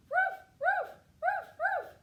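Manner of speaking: high, loud repeated puppy woofs; quick pairs of sharp dog barks. A narrator's reading. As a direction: bark insistently like a little puppy. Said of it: "the little puppy said".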